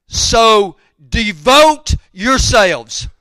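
loud, slow, drawn-out preacher's proclamation; emphatic scripture quote. Acted. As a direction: ring out each word high and forceful.

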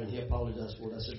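ordinary delivery

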